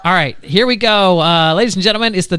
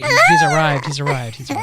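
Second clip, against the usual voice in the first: excited; 13 semitones higher than usual. Real, not acted. Announcing guest's arrival.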